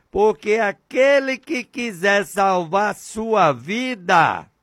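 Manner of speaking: slow, solemn preaching of the verse; scripture recitation. Acted. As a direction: intone the Bible verse slowly and gravely.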